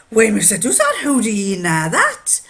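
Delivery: loud, astonished Geordie; Geordie accent. Retold or imitated. Miner wondering how the watchmaker knows, Geordie accent. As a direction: ask in broad imitated Geordie. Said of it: "he'd say it in Geordie".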